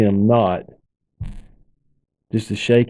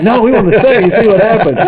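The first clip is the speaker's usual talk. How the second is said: put-on laughing kids' protest; 9 semitones higher, 4 dB louder than usual. Kids begging to stay.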